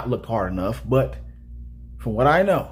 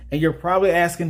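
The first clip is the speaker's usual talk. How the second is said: ordinary delivery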